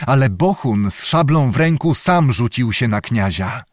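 plain reading voice

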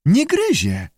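high squawky amphibian voice; screeched. A narrator's reading. Lizard parrots visitors to the startled keeper, screeching. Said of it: "zaskrzeczał płas".

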